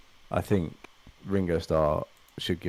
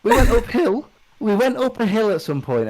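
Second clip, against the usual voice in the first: high, loud burst of excitement; excited; 14 semitones higher, 8 dB louder than usual. Real, not acted. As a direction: squeal it out with glee. Excited outburst at image.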